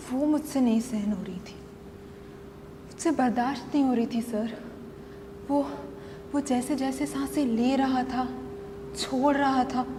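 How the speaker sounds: low, soft, halting confession; hushed menace. Acted. Wife unable to bear husband's breathing, hushed. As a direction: sink into quiet loathing. Sudden cold drop in confession.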